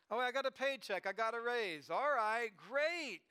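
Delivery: acting out a happy adult, pitch lifted at the end; pleased earner. Adult who just got a raise, building excitement.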